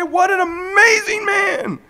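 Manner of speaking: hammed-up crowd cheering a hero; mock cheering crowd. Society cheering the quarterback with a mock, shouted, slow cheer.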